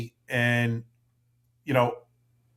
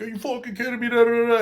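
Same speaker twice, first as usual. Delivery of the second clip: much higher pitched, exploding in a pretend rant; mock total anger; 12 semitones higher, 5 dB louder, faster than usual. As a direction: act out the angry blow-up he could have had. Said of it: "you could have reacted with total anger".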